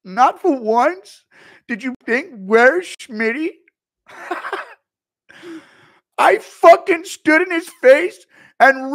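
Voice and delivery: high, very loud indignant rant; Schmitty rant. Retold or imitated. Streamer as Schmitty scolding partygoers, loud high rant. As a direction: yell it like a wounded ego.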